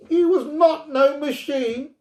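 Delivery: put-on high, loud, furious child; a girl screaming defence of a friend; fierce scream. A narrator's reading. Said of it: "screamed Gloria fiercely".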